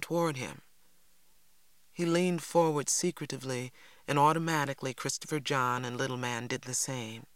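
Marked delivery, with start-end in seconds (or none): none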